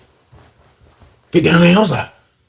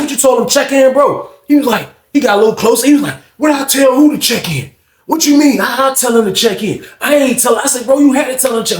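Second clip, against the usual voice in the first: retold argument; 7 semitones higher than usual. Heated argument before a fight.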